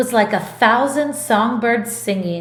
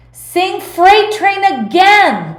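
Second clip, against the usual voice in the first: high, loud, slow group shout; shouting request; 9 semitones higher, 6 dB louder, slower than usual. A narrator's reading. Everyone in the house shouting for the song again. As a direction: call it out, begging for more. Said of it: "they shouted".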